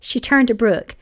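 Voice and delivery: ordinary delivery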